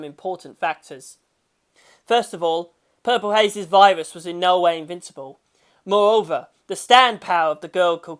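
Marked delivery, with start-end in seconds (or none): none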